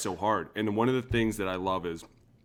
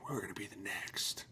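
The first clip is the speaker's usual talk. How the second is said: fading hushed boast; 4 dB quieter than usual. Retold or imitated. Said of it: "we were like".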